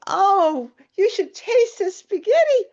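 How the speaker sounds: high, loud giddy squeal, acting out being tickled. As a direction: exclaim it high and loud, hammed-up giddiness. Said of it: "I started laughing".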